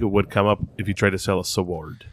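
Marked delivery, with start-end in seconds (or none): 1.4-2.1 "sword" stretched into "suh-word"; exaggerated W. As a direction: break "sword" open with a full w. Teasing after being corrected on sword.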